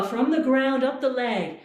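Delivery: ordinary talk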